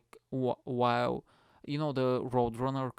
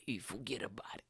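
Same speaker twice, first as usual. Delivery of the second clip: hushed whisper; 2 semitones lower, 9 dB quieter than usual. Pretend. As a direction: murmur it as a tossed-off aside. Whispered mafia-style aside.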